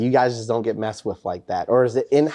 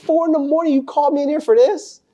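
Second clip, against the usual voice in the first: outraged disbelief; 13 semitones higher than usual. Retold or imitated. To the Master Sergeant at four a.m., high outrage. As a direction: protest being dragged in at dawn. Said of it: "I'm like".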